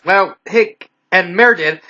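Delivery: plain reading voice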